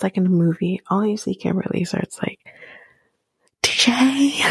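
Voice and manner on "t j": higher, drawn-out mock call. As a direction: imitate her summoning him from afar. Acts out Kimberly calling for T J.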